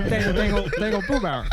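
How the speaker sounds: repetitive Texas gibberish mumble; Boomhauer. Acted. Cartoon Texan babbling nonsense for laughs, garbled mumble. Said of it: "I'm doing Boomhauer".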